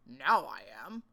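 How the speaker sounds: a lightly growled bear voice; low growly bear, gently done; mild growly bear. A narrator's reading. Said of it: "said a growly voice".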